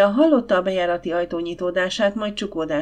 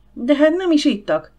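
high, louder indignant protest; 7 semitones higher, 5 dB louder than usual. A narrator's reading. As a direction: object loudly to losing the tea. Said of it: "tiltakozott az asszony".

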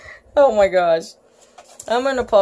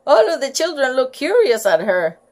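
ordinary delivery

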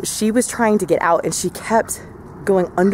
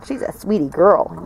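usual speaking voice

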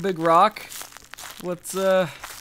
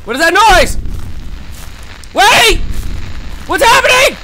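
panicked screaming; 13 semitones higher, 6 dB louder, faster than usual. Real, not acted. Monster attack in horror game.

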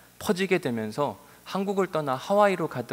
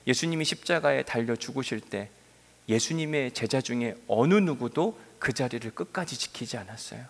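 ordinary talk, nothing marked

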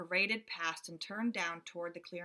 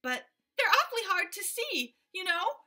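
much higher, chattering monkey voices; little monkeys; 10 semitones higher, 6 dB louder than usual. A narrator's reading. Monkeys reassuring the gorilla in high squeaky voices. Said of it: "said the six monkeys".

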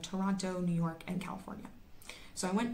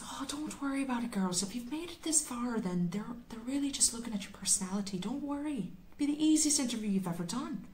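hammed-up, higher-pitched bad Irish accent; terrible Irish accent; 5 semitones higher than usual. Hotel minder reassures the nervous girls, in a terrible mock Irish accent. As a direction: play a relaxed Irish minder in a clumsy Irish lilt. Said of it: "that's my terrible Irish accent".

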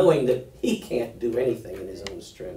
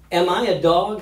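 raised, louder roar as Goliath; 6 semitones higher, 6 dB louder than usual. A narrator's reading. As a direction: roar the challenge, pitched high. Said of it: "he roared at David".

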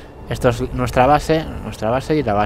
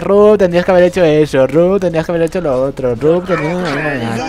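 louder mock nagging commenter; 4 semitones higher, 4 dB louder than usual. Gamer imitates viewers' comments, mild mock nagging. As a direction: parrot the know-it-all viewers. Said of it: "seguro que en los comentarios".

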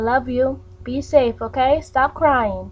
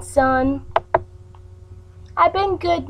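usual speaking voice

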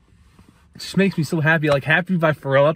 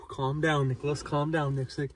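quiet self-soothing; 4 semitones lower, 8 dB quieter than usual. Real, not acted. Calming himself down after excitement.

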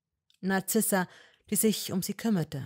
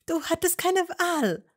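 put-on higher young woman's voice, pleading and firm; Hermine voice; 13 semitones higher, 6 dB louder than usual. A narrator's reading. Hermine to Malfoy, higher and insistent. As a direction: argue with him, voice raised high.

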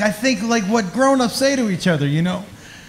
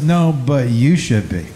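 low, slow, knowing reply; a hint of a menacing woman; lightly voiced woman, slow and low; sly threat; 9 semitones lower than usual.

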